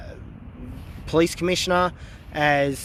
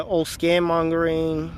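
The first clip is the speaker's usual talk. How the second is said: ordinary talk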